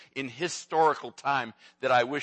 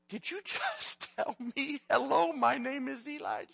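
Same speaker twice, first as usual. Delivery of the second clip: laughing disbelief; 13 semitones higher than usual. Retold or imitated. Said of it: "she laughs says to him".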